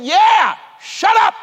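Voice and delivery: high, loud, curt reply; God's blunt answer. Retold or imitated. God answering the desperate preacher, loud curt retort. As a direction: snap it back like a stern answer from above. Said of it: "He said".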